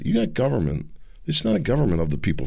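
ordinary talk

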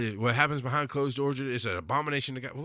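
normal talking voice

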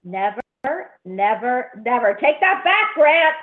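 laughing refusal, pushing the news away; high playful scolding of Grant; playful protest. Real, not acted. Refuses being told she's grandmother.

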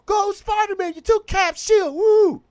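high, loud cheering like a crowd; imitated crowd cheering at the screen; cheering fan. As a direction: whoop it up like fans in a theater. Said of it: "we all was like".

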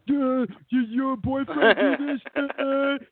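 slow, high, dopey drawl. Retold or imitated. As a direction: play it dumb and slow.

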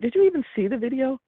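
high, pleading disbelief; desperate plea. Retold or imitated. Mother asking the social worker about the video, desperate and high. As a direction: beg her for an answer, voice up high.

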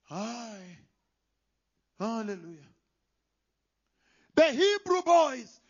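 ordinary talk, nothing marked

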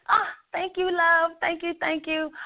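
high, gushing thank you; delighted thanks. Real, not acted. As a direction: gush with delight at the compliment. Thanked for a compliment.

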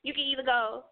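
usual speaking voice

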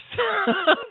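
short rueful laugh, high. Real, not acted. Host laughing about his own divorce, rueful laugh. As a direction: laugh at your own failed marriage.

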